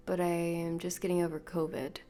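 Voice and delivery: ordinary delivery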